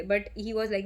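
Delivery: ordinary talk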